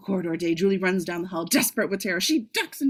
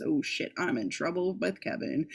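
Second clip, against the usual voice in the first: ordinary talk, nothing marked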